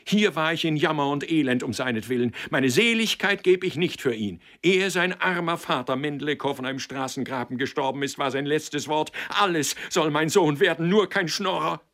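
high, loud impassioned tirade; wild cry. A narrator's reading. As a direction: pour it out frantically. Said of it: "rief sie wild".

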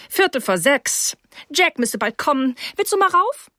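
high-voiced hostess, questions rising sharply in pitch; brisk hostess. Mrs Rose hurries her guest along, high hostess voice.

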